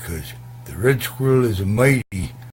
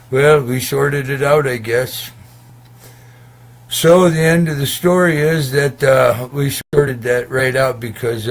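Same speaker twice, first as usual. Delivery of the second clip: raised excited wrap-up; 5 semitones higher, 9 dB louder than usual. Real, not acted.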